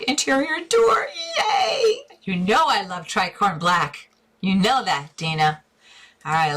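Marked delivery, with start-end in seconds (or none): 0.0-2.0 squealing, high-pitched yay; excited cheer. Real, not acted. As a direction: rise into a high, delighted squeal. Cheering a viewer's favorite paint plan.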